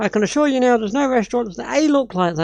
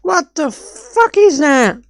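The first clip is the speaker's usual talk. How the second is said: slow, higher, louder, every word dragged out; baffled outrage; 6 semitones higher, 4 dB louder, much slower than usual. Real, not acted. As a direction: stretch it in utter disbelief. Baffled by wrong landmark footage.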